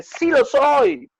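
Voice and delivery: put-on high, slow stubborn cry of a boy; a son stubbornly insisting he is stupid; insistent son. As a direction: dig in, drawn out and loud. Son insists, acted dialogue.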